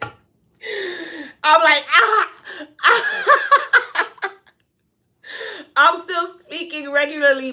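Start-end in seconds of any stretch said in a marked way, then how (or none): 1.9-4.3 high-pitched fake choking cries; mock choking gasps. Telling how she choked on a nacho, mock choking gasps. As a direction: gasp as if choking, hammed up for laughs. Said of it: "still choking at the same time".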